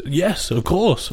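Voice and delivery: high, drawn-out eager yes; enthusiastic. Retold or imitated. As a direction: imitate someone keen to oblige. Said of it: "he'd enthusiastically run to you".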